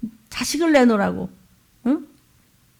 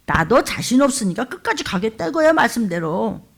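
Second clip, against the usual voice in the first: ordinary talk, nothing marked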